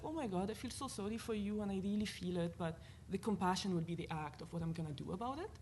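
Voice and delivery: normal talking voice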